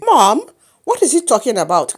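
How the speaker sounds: loud, startled call to Mom; a touch of shocked protest; alarmed appeal. Acted. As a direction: demand an explanation from mother. Alarmed child appeals to mother.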